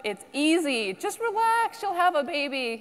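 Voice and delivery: high, airy put-on voice of the breezy advice-giver; mocking easy advice. Speaker mimics the well-meaning crowd offering easy advice, high and breezy. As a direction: mimic people telling her to just relax. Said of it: "a lot of people in the audience think this is fun".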